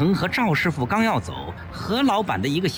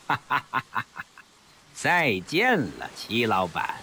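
cold laugh; 4 semitones lower, 3 dB quieter than usual. A narrator's reading. Smuggler gloating as he escapes by boat, cold laugh. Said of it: "冷笑一声".

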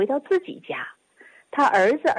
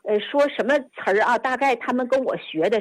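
ordinary talk, nothing marked

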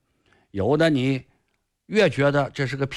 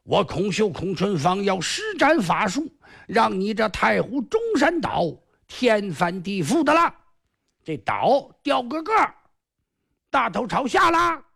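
a lightly raised, smug villain's voice; boastful sly sorcerer; 6 semitones higher than usual. Acted. Sorcerer Kong Xiu boasting to the hero, smug and a bit higher. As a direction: crow over the island like a cocky magician.

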